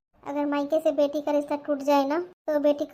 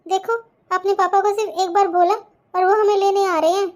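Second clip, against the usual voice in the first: put-on upset little girl; 5 semitones higher, 6 dB louder than usual. Child comforting crying mother.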